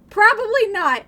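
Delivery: loud high-pitched worry. Real, not acted. Streamer to chat, a loud high yelp. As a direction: yelp it out in mock dread. Fearing playlist exposes him.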